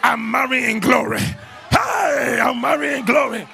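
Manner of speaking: high, loud, drawn-out proclamation; full-force preacher's cry of victory; triumphant declaration. Shown as a demonstration. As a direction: shout it out in defiant faith. Demonstrates defiant declaration after heartbreak.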